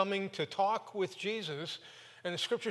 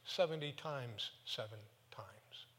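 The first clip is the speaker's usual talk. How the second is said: hushed, like sharing a secret; Jesus answering Peter in a hushed, gentle whisper; soft whisper; 5 semitones lower, 7 dB quieter than usual. Retold or imitated.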